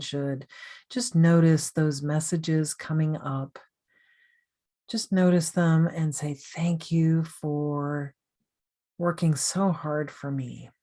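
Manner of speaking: guided meditation. Real, not acted. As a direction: gently guide the listener through breathing and self-thanks, unhurried.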